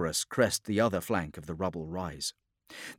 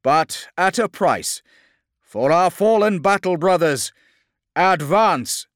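loud, high rallying cry; rally the troops to charge; impassioned shouted battle order; lieutenant over vox; 10 semitones higher, 11 dB louder than usual. Acted. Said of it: "voxed Lieutenant Cassian".

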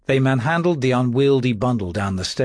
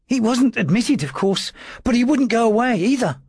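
high, thin timid clerk's voice; a frightened servant trying to sound firm; nervous little secretary; 10 semitones higher than usual. A narrator's reading. Dawes answering his lordship's questions, high and nervous.

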